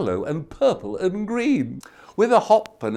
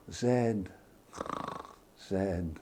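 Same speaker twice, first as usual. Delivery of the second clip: fading softer and lower into sleep; drifting off; 8 semitones lower, 14 dB quieter, much slower than usual. Acted. Goldilocks falling asleep, fading voice. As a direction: let each word sink away to nothing. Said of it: "She closed her eyes".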